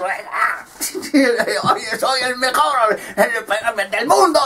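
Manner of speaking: high, laughing self-hype; high giddy bragging with laughs; cocky cheering boxer. Acted. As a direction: laugh and hype yourself up to the crowd. Boxer cheering himself into ring.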